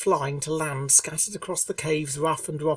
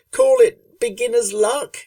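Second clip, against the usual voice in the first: louder, higher, a bit flustered; defensive Rainbow; 9 semitones higher, 9 dB louder than usual. A narrator's reading. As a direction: brush it off nervously. Rainbow shrugging it off.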